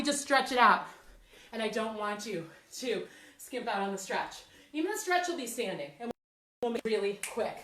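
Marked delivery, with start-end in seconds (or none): none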